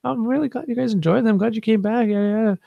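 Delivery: put-on very high, perky girl voice; imitated pepped-up bartender. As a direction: gush in an exaggerated high pitch. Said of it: "she's just like".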